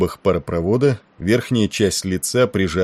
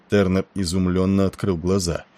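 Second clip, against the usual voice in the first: ordinary delivery, nothing marked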